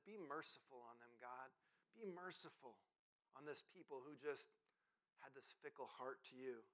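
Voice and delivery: low, very soft prayer; hushed plea. Retold or imitated. Moses begging God for mercy on the people, quietly pleading. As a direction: plead softly and humbly, letting the phrases pause. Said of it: "cries out to God".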